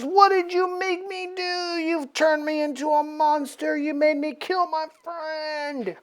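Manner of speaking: high, strained mock yell without the volume; hammed-up anguished accusation. Warren accusing Apocalypse of making him kill, mock-anguished. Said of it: "starts yelling at Apocalypse".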